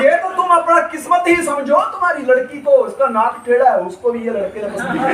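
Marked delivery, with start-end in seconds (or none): none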